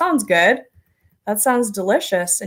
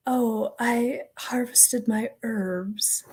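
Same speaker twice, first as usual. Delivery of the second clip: pretentious; 9 dB quieter, much slower than usual. Retold or imitated. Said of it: "it sounds, um, pretentious or something".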